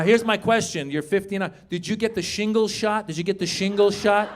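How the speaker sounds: ordinary delivery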